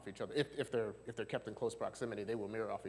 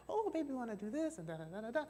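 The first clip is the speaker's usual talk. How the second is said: put-on very high, lilting baby talk; exaggerated singsong cooing, way up high; sing-song baby talk; 12 semitones higher than usual. Shown as a demonstration. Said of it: "it sounds like sing-song kind of thing".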